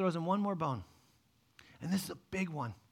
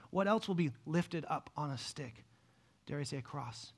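normal talking voice

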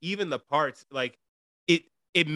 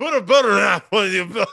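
louder and higher, laughing through it; laughing retry; 7 semitones higher, 8 dB louder, faster than usual. Real, not acted. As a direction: try again while cracking up. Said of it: "did you try to say butter knife?".

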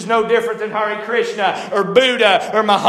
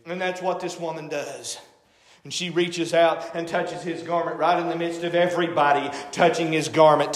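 ordinary talk, nothing marked

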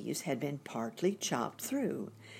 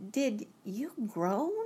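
high, slow, careful question; tentative, drawn-out inquiry, pitched high; girl's wondering question; 6 semitones higher, slower than usual. A narrator's reading. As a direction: ask slowly in a lifted girlish voice. Girl questions the groaning stranger.

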